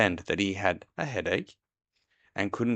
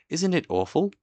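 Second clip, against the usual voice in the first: high, loud, scared little voice; pitched up, a timid pig's alarm; frightened Piglet; 10 semitones higher, 5 dB louder than usual. A narrator's reading. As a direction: squeak it out in dread.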